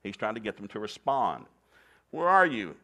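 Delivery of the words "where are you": loud, high searching call; raised voice, higher and louder, calling. A narrator's reading. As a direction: call across a garden for someone hiding. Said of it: "God called to the man".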